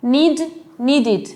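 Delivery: "needed" with an added "id" syllable after "Need"; bare "Need", then "needed" ending in a sounded "id"; extra "id" syllable. Shown as a demonstration. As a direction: say "needed" in two clear beats, need-id. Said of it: "agregar la pronunciación id".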